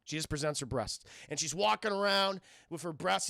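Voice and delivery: ordinary delivery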